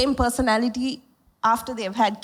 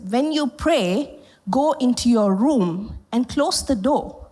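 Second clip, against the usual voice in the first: slow scripture quote; slower than usual. Acted. Quoting Jesus slowly.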